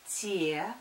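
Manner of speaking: spelled out syllable by syllable; dictating. Shown as a demonstration. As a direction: spell «Те-а-т-р» slowly in parts, as written. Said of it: "Пишу". (диктует по слогам)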